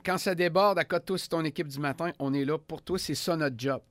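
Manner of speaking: upbeat radio host sign-off; punchy morning show announcer. Acted. Radio host wrap-up and station ID. (animateur radio enjoué)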